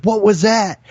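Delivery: high, startled question; startled mom. Retold or imitated. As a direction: ask it in sudden alarm. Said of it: "she goes".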